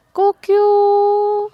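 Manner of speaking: high, loud cock-a-doodle imitation; rooster crow. Shown as a demonstration. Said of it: "A ver, canta". (canto de gallo agudo y fuerte)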